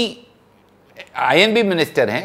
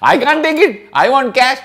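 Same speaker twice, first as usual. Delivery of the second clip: pitched high and louder, pushing for the cash; insistent retold demand; 9 semitones higher, 5 dB louder than usual. Young winner on stage insisting to the President, higher and louder. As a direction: insist like a broke young man refusing to leave.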